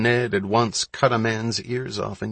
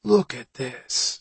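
slow, higher-pitched, intrigued; rising interest; 4 semitones higher, slower than usual. A narrator's reading. Johnny to Mullen at the wrecked barge, intrigued. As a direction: draw the words out as interest grows. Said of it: "interest rising in his voice".

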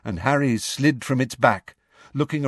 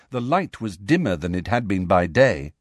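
ordinary delivery, nothing marked

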